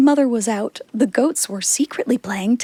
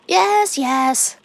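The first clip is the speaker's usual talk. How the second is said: little goats; 5 semitones higher than usual. A narrator's reading. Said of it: "said the seven little goats".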